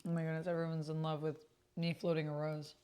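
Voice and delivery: ordinary talk, nothing marked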